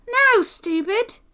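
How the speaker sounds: high, slow shouted rebuke; exclamation. A narrator's reading. Boy colliding in the doorway, shouting at the other. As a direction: bark it at the clumsy boy. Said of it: "This last exclamation arose".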